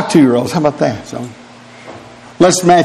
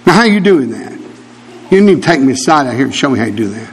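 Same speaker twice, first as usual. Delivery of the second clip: ordinary talk, nothing marked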